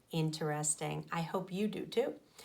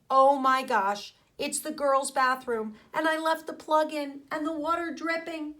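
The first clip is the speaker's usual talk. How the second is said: put-on high, loud panicked gasp of a boy; a kid realizing his mistake; alarmed; 7 semitones higher, 4 dB louder than usual. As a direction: blurt it out in alarm. Arnold panics about bathroom.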